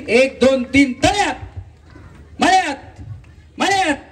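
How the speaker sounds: game host's high call; calling game commands. Real, not acted. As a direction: count in, rising to high calls. Host calls out game commands.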